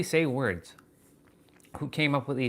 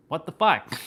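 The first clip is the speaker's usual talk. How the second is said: higher, louder burst of real surprise; startled swear; 5 semitones higher, 5 dB louder than usual. Real, not acted. Noticing he keeps saying E words, startled outburst. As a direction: blurt it out, caught off guard. Sudden realization about E words.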